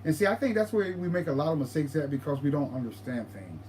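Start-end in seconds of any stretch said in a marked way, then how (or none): none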